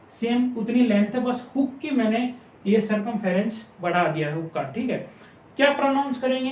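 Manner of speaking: normal talking voice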